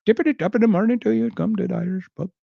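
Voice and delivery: fast, high, hammed-up Indian accent; mock Indian accent. Retelling the Irish pub sign joke, mock Indian accent. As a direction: rattle it off in a silly put-on Indian lilt. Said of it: "the story about the Irish board".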